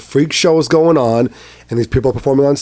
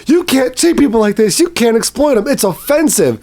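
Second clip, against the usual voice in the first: high, mocking righteous outrage; 9 semitones higher than usual. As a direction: parody the self-righteous protester. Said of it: "you had people fighting, like".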